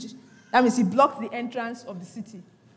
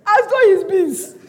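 high, loud and slow through laughter; laughing incredulously; 8 semitones higher, 9 dB louder, slower than usual. Real, not acted. Preacher jokes about the boiled son, laughing exclamation. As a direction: exclaim it while cracking up.

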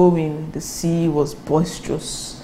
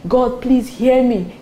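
raised, drawn-out begging; mock crying plea; 6 semitones higher, slower than usual. Preacher showing the prayer Jonah avoided, mock pleading. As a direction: stretch out a mock supplication. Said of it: "Instead of crying".

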